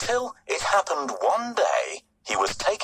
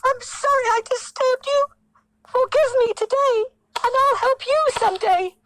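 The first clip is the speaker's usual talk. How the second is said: very high squeaky flattering mouse; tiny pleading mouse; 5 dB louder, faster than usual. Acted. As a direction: sweet-talk the king in a squeak. Mouse begs.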